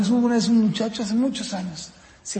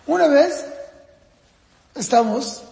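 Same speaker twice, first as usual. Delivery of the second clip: ordinary talk, nothing marked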